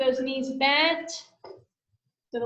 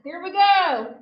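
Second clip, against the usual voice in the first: loud, drawn-out coach callout; barking gym coach; 3 semitones higher, 6 dB louder, slower than usual. Real, not acted. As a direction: call the move out to the class. Fitness coach cueing exercise.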